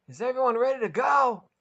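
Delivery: loud high shout over a roaring engine. Acted. Max shouting to passengers over the saucer engine. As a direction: call out over the noise. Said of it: "Max shouted over the engine".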